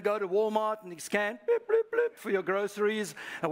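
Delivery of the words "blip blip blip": high, loud mock scanner beeps; playful high beeping sound; imitated scanner beeps. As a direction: beep it out like a checkout scanner.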